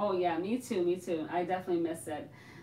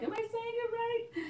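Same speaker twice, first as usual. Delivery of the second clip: squeaky high "Am I saying it right?" in a far higher voice; mock worried question; 13 semitones higher than usual. To viewers, fretting over a name, in a squeaky high voice. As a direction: squeak out the question like a nervous kid. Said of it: "so that's why I'm like".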